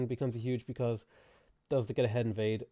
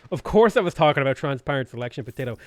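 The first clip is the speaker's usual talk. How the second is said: louder, put-on indignant comeback; mock know-it-all; 7 dB louder than usual. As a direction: act the offended big brain. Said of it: "You could have been like".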